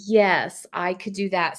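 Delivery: ordinary talk, nothing marked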